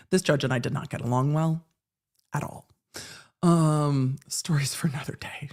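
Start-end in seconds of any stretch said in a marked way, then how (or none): 4.3-5.5 whispered aside. Real, not acted.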